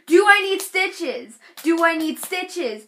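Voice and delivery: high, loud insistent question; anxious pleading. Retold or imitated. Hurt girl to onlookers over stitches, mock frantic. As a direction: retell a frantic repeated ask.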